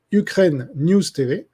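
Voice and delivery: « Ukraine News T V » articulé lentement, mot par mot; nom détaché lentement. Real, not acted. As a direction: ralentir pour bien faire retenir « Ukraine News T V ». Repeats channel name for viewers. (répète le nom de la chaîne aux spectateurs, lentement)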